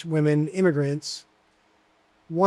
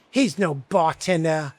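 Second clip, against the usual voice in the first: gruff grandmother; slower than usual. Retold or imitated. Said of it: "She was very gruff with me".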